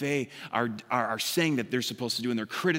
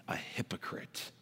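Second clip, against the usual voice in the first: low, hushed whisper; 8 semitones lower, 13 dB quieter than usual. Real, not acted. Preacher whispering Jesus's warning to hypocrites. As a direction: drop to a grave whisper.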